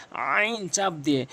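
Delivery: আইন squeezed slowly from the throat; pressed ain. Shown as a demonstration. (চাপ দিয়ে আইন)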